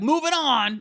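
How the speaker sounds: high, brisk dismissive voice; late partner's voice. Retold or imitated. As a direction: brush it off briskly. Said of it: "He'd be like".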